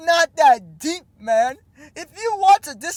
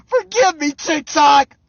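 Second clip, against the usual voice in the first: louder, higher hammed-up plea; mock pleading cry; 3 semitones higher, 8 dB louder than usual. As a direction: beg dramatically like a melodrama. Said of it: "That's how you should have responded".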